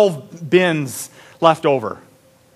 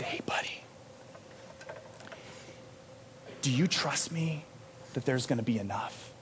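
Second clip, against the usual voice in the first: gentle whisper; 5 semitones lower, 14 dB quieter than usual. Retold or imitated. Counselor gently whispering to the panicked camper. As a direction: whisper it softly to calm a scared kid. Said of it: "I simply looked him in the eyes and I said".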